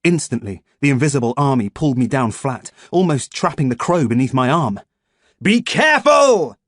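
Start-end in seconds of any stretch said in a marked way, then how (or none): 5.4-6.6 much higher, loud, slow; furious bellow at the soldiers; thundered. A narrator's reading. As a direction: boom the warning. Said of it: "he thundered".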